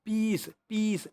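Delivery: "पीस" said slowly with a long ee, twice; drawn-out vowel. Shown as a demonstration. Teacher demonstrating the long sound, slow drawn-out "पीस". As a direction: stretch the ee in each "पीस". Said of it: "आपको लंबा sound देखने को मिल रहा है".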